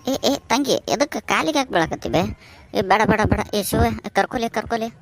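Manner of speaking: high-pitched, urgent exclaiming; flustered protest. Acted. Father-in-law stops daughter-in-law falling at his feet, flustered. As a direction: stop them in alarm, voice jumping up.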